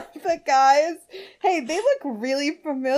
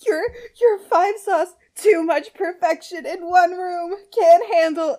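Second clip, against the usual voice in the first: starstruck; 6 semitones higher, 5 dB louder than usual. Acted. Starstruck fan line.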